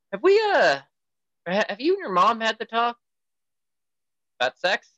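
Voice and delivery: high, strained, hesitant asking; awkward nervous dad. Retold or imitated. Dad asking daughter about the sex talk, nervously high. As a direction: squeak out the question, embarrassed. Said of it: "I was like".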